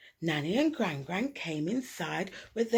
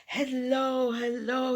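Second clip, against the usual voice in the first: 1 Uncle's call; 4 semitones higher, 4 dB louder, slower than usual. A narrator's reading. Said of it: "he said".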